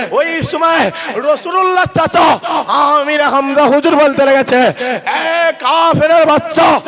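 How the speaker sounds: high, loud, impassioned preaching at full force; fiery sermon. Acted. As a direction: thunder it out to the crowd like a waz preacher.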